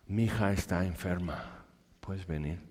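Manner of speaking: quiet, low begging plea; soft pleading. Acted. Padre desesperado a Jesús, suplicando en voz baja. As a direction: beg softly for a dying daughter. Desperate father begging Jesus.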